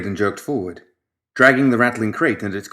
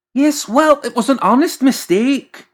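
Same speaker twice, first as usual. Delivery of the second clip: much higher, louder defensive whine; whiny self-defence; 5 dB louder than usual. Acted. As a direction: plead innocence from way up high. McMorning defensive.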